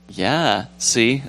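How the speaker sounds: usual speaking voice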